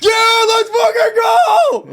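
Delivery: high, loud, stretched victory yell; triumphant shout. Real, not acted. Celebrating a correct guess.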